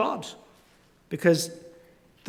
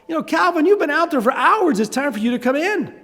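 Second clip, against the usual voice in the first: much higher, calling from far off; dad calling Calvin in after hours; 9 semitones higher, 3 dB louder than usual. Retold or imitated. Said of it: "yells out".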